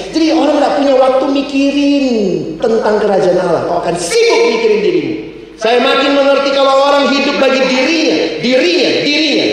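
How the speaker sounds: high, insistent sermon delivery; impassioned preaching. Real, not acted. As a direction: drive the point home to the congregation. Preaches at full force.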